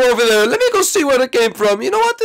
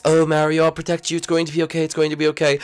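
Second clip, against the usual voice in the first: comforting; 7 semitones lower, faster than usual. Acted. Rosalina comforting wounded Mario.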